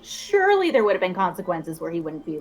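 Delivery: ordinary talk, nothing marked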